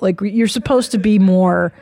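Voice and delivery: ordinary delivery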